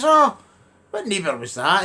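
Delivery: high startled "Oh"; a hint of nosy astonishment; mock surprise. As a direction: feigns shock at the gossip. Voicing himself asking Joe.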